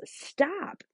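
sharp high cry of stop; exclaimed stop. A narrator's reading. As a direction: Samuel halting Saul mid-speech, a high sharp stop. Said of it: "exclaimed Samuel".

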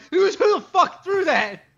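high, slow outraged yell; mock heckler yell. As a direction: yell it like the drunk fan. Mocking fan heckle, laughing.

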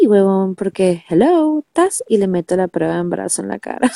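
ordinary talk, nothing marked

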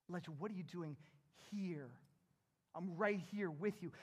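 soft, low and reassuring; gentle, close-by voice. Acted. God beside Elijah in the cave, tender and hushed. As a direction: speak quietly, as if right next to him. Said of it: "God was right next to Elijah".

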